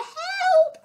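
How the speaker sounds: very high, loud "Help!"; witch's cry. A narrator's reading. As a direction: scream for help. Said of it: "cried the witch".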